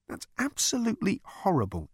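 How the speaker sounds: high, appalled outburst; exclaiming Arthur. A narrator's reading. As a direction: recoil in disgust. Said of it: "exclaimed Arthur".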